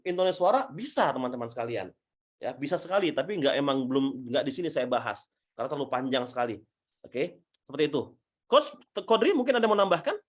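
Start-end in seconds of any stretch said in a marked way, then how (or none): none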